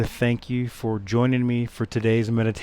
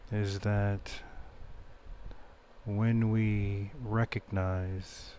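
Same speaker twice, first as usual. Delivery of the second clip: soft, unhurried meditation voice with long pauses; guided meditation; 4 semitones lower, 13 dB quieter than usual. Acted. Meditation teacher leading listeners back to the breath, soft guided-meditation voice. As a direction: guide them gently, much softer than a welcome. Leads a meditation after intro.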